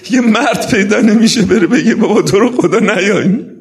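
loud, high tearful cry of regret; weeping lament. Acted. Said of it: "این گریهٔ مسلم ابن عقیل مظلوم است".

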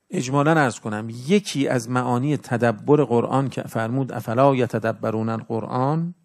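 usual speaking voice

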